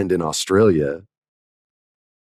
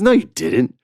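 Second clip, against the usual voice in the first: higher-pitched, mock incredulous denial; disbelieving; 10 semitones higher, 3 dB louder than usual. Seatmate doubts he wrote the episode, disbelieving. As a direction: imitate a listener who won't believe it.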